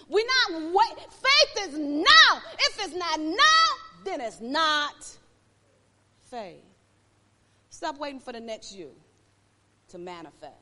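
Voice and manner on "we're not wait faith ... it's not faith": loud, high, driving sermon cry; preacher pushing the congregation hard, loud and high; preaching at full force. Acted.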